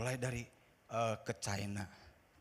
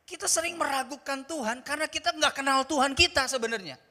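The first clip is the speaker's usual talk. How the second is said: preached restatement; 10 semitones higher, 8 dB louder than usual. Acted. Restated point, loud.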